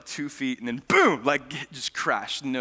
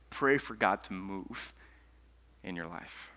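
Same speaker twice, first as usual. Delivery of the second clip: soft, lower, gentle preaching; quiet sermon; 3 semitones lower, 5 dB quieter than usual. Acted. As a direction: draw the room in close.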